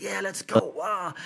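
hyped 'let's go', thrown high; very excited. Retold or imitated. Young star imitated cheering in press conference, excited and high. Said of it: "He's very excited".

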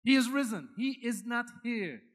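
usual speaking voice

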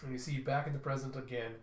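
normal talking voice

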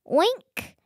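high, squeaky little oink; piglet oink. Acted. Reading the farm story aloud, piglet oinks high and squeaky.

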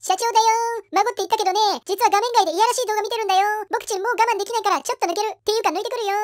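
silly mock company president; mocking boss impression. Employee mocking the absent president, silly impersonation. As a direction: play the boss as a childish fool.

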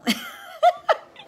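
high laugh. Real, not acted. Recalling the warlock's call.

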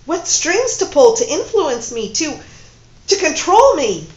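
very loud, high angry accusations; furious. Acted. Woman raging at her lover who tricked her, loud fury. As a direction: hammer him with each charge.

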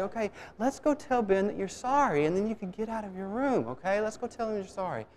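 high, soft, coaxing; nice-guy dad. Retold or imitated. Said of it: "I'm gonna be the nice guy".

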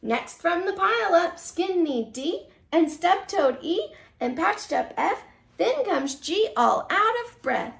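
lively storybook read-aloud; reading to children. Acted. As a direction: read it like a bedtime picture book. Reads picture book to children.

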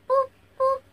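two high, squeaky boops; playful boops. Acted. Vlogger showing capsules to viewers, playful high boops.

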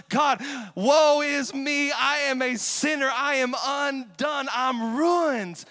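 loud, high, slow cry of being undone; anguished confession. Retold or imitated. A sinner crying to God, loud and broken. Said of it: "that is the only response".

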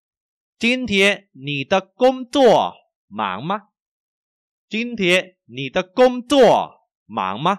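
slow, high, carefully toned question; language teacher model reading. Shown as a demonstration. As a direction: spell out every tone clearly. Models sentence for learners. (đọc mẫu chậm, rõ từng thanh)

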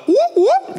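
very high, short siren whoops; imitated police siren. Pastor imitating the police pulling him over, siren whoop. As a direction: mimic a squad car's whoop.

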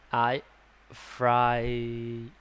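ordinary delivery

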